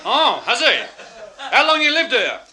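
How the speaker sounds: high, loud interrogation; shouting desk sergeant; sergeant's loud questioning. Retold or imitated. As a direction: bellow the questions at the prisoner.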